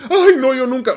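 high, loud panicked protest, hammed up; mock alarm. Person told they will die, mock alarm. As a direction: recoil in exaggerated alarm. Acts person refusing to face death.

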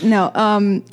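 ordinary talk

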